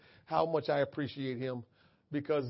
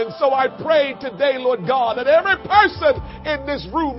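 prayer building to preaching; 4 semitones higher, 11 dB louder than usual. Acted. Leads closing prayer, building.

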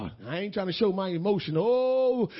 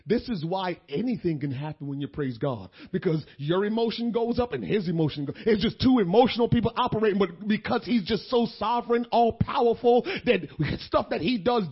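usual speaking voice

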